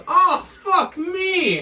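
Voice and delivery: high, slow cry of pain through a laugh; mock yelp. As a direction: ham up his shocked yelp. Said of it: "he just goes".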